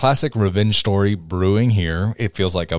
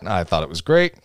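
ordinary talk, nothing marked

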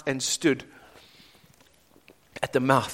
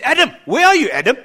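calling out; 7 semitones higher, 7 dB louder, faster than usual. Pretend. God calling Adam in garden.

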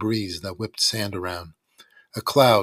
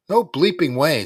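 angry refusal; 7 semitones higher, 7 dB louder than usual. A narrator's reading. Izzy refusing Danny.

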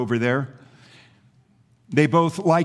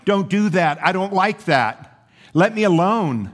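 higher, protesting cry of a teacup; 6 semitones higher, 4 dB louder than usual. Acted. Lump of clay to the master shaping it, crying out. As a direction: cry out in protest, voice lifted and louder by the end. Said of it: "and I cried out".

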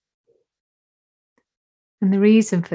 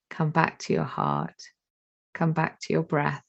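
slow, calm meditation guide voice; guided meditation. Acted. Teacher guiding attention to breath and heart, slow meditation voice. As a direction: let each phrase settle, unhurried.

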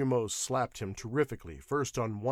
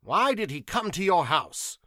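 raised, pressing question; higher-pitched, insistent questioning; firm interrogation; 8 semitones higher, 9 dB louder than usual. A narrator's reading. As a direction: question the suspect firmly and loudly. First asking in an interrogation.

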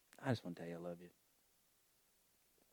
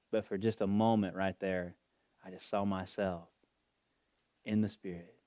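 ordinary talk, nothing marked

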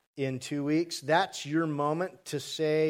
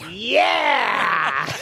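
loud, high cheer; delighted shout; 6 semitones higher, 10 dB louder than usual. Real, not acted. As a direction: whoop with delight. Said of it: "delighted after the impression".

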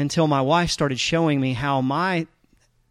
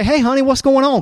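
high, bright and loud, all innocence; breezy hello to his wife, much higher and louder; cheery phone greeting; 9 semitones higher, 6 dB louder than usual. Retold or imitated. Calling wife, forgot the fight.

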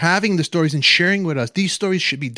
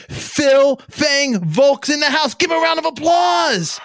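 booming showman intro, much higher, louder; animal show trainer; 9 semitones higher, 3 dB louder than usual. Acted. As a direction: hype the crowd like a ringmaster. Hypes guest like a showman announcer.

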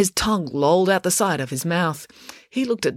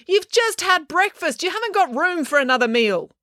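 calling after a dog; 13 semitones higher than usual. A narrator's reading. April running after her dog, calling out. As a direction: scold the dog in a raised, stretched-out voice.

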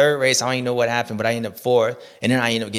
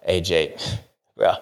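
imitating J-Bone; 6 semitones lower than usual. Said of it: "J-Bone probably be like".

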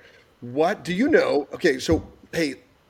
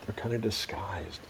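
whispering; 6 semitones lower, 11 dB quieter than usual. Real, not acted. Hushed aside to kids.

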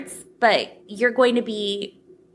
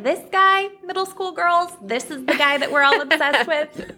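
gushing schoolgirl; 5 semitones higher than usual. Retold or imitated. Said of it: "I was like".

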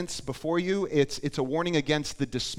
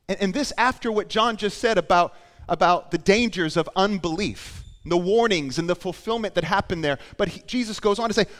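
loud, high, driven preaching; impassioned preaching; 6 semitones higher, 8 dB louder than usual. Real, not acted. Preacher recalling warnings of unbelief, loud and impassioned. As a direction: keep preaching at raised pitch and force.

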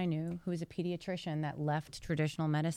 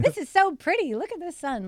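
ditzy Blondie voice; 11 semitones higher, 9 dB louder than usual. Retold or imitated. Meeting the bartender, hamming up a ditzy blonde. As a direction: play the clueless blonde saying hi, much higher and louder. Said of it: "Like, I was like that, like this".

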